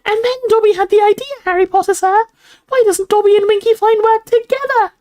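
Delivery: very high, loud elf squeak; shrill house elf. A narrator's reading. Dobby talks over screeches, shrill squeaky shout. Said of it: "shouting shrilly over Winky's screeches".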